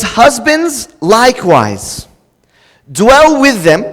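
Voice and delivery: slow, weighty reading of "Husbands likewise, dwell"; solemn slow scripture read; slow scripture emphasis. Shown as a demonstration. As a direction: lean on each word slowly. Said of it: "Let me get everyone's attention".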